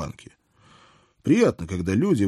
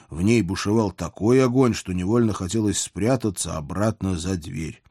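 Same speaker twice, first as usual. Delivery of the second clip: ordinary delivery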